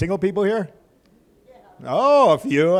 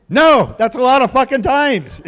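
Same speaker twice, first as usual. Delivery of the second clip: loud punchline; 10 semitones higher, 8 dB louder than usual. Real, not acted. Punchline to the crowd.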